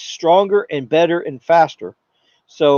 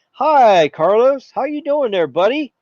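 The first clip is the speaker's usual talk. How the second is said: high, friendly greeting to Carlos; a cheery cell tower welcoming a 5G phone; chummy tower; 4 semitones higher than usual. Pretend. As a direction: greet him like an old pal. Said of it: "And the tower says".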